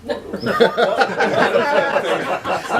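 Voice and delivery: high, helpless laugh of the quizmaster; big laugh. Real, not acted. Quizmaster cracking up at a cheeky answer, laughing hard.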